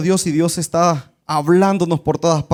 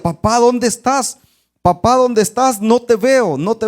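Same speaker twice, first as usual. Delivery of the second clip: put-on frightened child calling; 6 semitones higher than usual. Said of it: "el niño decía".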